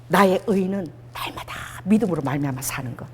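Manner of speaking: low, half-whispered statement of faith; hushed confession. Shown as a demonstration. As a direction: say it softly, almost in a whisper.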